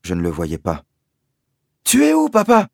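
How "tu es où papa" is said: high loud question; boy searching for his father, calling out. Acted. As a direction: call into the dark.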